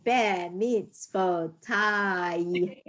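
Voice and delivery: drawn-out syllables, read slowly; slow sounding out. Shown as a demonstration. Teacher sounding out a Hebrew word slowly. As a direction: sound out each syllable for the class. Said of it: "where do we have the vo sounds".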